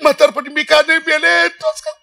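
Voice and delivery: hammed-up tearful keening; imitated sobbing lament; mother's renewed wailing. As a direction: cry out to the holy man in mock grief. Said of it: "त्या आईला तर जास्त ऊर भरून आला".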